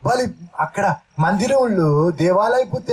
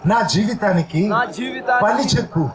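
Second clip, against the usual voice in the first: preacher leading prayer aloud, phrase by phrase; fervent prayer; 2 semitones higher than usual. Acted. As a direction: pour it out to God with the church. Leads congregation in prayer. (ప్రార్థనలో ఉత్సాహంగా)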